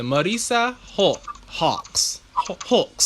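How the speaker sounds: American having a go at British "Marissa Hawks"; halting, imitation British pronunciation; attempted British accent. Shown as a demonstration. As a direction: try the name in a British accent, feeling for the vowel. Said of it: "the British say Hawks".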